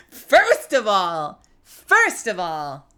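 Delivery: loud, slow, drawn-out protest; mock indignation. Real, not acted. Streamer answering chat about the song, mock indignant. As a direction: stretch each word out loudly in playful objection.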